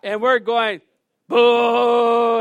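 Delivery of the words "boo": big, long crowd boo; loud boo. Retold or imitated. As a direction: boo him off like a rowdy audience. Men in crowd booing.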